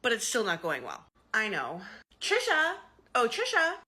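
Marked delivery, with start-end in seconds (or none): 2.2-2.8 calling out. Acted. Calling out across the store. 3.1-3.8 calling out. Acted. Calling out across the store.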